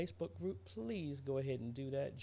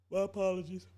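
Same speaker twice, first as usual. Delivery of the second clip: talking through a yawn, higher and louder; 7 semitones higher, 7 dB louder, faster than usual. Real, not acted. Streamer excusing yawns from blood sugar levels, yawning. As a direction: apologise mid-yawn. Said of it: "So I'm yawning".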